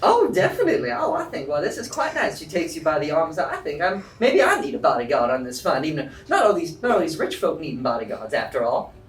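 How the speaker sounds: coy and charmed; flirty Joy. Acted. Joy charmed by the dragonborn, asking him to be her bodyguard.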